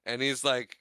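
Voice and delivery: ordinary talk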